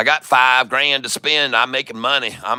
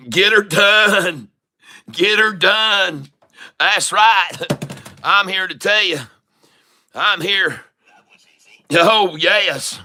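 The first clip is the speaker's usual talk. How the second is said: hammed-up country crowing; 6 semitones higher, slower than usual. Trader celebrating his win with drawn-out, high country whoops. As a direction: crow it slowly like a triumphant good old boy.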